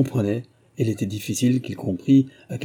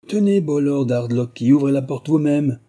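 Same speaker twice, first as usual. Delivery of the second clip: high, loud taunting cry; loud cheeky taunt; mocking shout; 6 semitones higher, 5 dB louder than usual. A narrator's reading. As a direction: jeer it at him with a grimace. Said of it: "cria-t-il à David avec une grimace".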